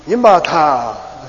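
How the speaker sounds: slow, drawn-out sarcastic Yimba ta; sarcastic tell. Shown as a demonstration. Teacher shows students a sarcastic Yimba ta, slow and drawn out. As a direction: drag out Yimba ta as a sarcastic jab. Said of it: "just a sarcastic question".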